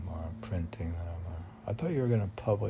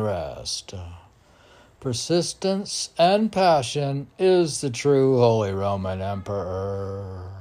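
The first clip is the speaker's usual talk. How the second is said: louder, declaimed verse; poem recitation; 3 semitones higher, 7 dB louder than usual. Acted. Poet declaiming his verse to the host, loud. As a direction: proclaim each line.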